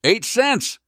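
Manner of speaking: high, loud bark of disbelief; indignant customer's sharp exclamation; barked outburst. A narrator's reading. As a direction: bark it back, pitch leaping up. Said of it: "Mark barked".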